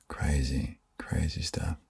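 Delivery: soft unvoiced whisper. Real, not acted. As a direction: breathe it out secretly. Soft aside in ASMR chat.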